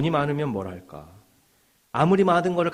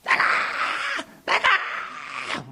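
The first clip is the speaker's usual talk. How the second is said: mimicked high-pitched, drawn-out yell; imitated screaming; much slower than usual. Preacher re-enacting violence from a leaked video, imitated screaming. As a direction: act out a screamed order to get out. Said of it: "막 소리를 지르고 폭행을 하고".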